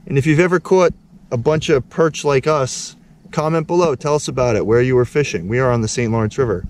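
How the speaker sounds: loud upbeat outro read; YouTube outro. Acted. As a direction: pitch the channel with energy. Outro call to subscribe.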